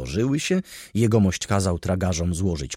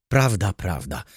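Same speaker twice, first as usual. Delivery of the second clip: ordinary delivery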